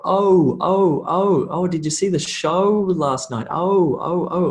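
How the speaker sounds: OH as a gliding diphthong; over-enunciate the glide in every OH; combined vowel sound. Shown as a demonstration. Teacher giving examples of combined vowels, emphatic OH glide demo. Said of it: "these are combined sounds".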